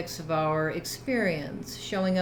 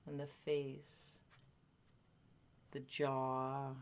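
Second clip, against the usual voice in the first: guided meditation; 2 semitones lower, 12 dB quieter than usual. Real, not acted. Teacher settling a group into body awareness, guided meditation. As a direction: gently lead listeners into stillness, leaving space between phrases.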